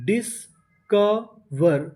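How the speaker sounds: Discover very slowly in three separate parts; syllable by syllable. Shown as a demonstration. Teacher sounds out a word for learners, discover slowly broken up. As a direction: leave gaps between the pieces of discover. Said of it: "discover का मतलब खोज करते हैं".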